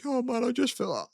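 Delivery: high mock-weepy drunken whine; sad crying drunk. Drunk guy pouring out feelings, mock crying. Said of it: "the sad, crying drunk".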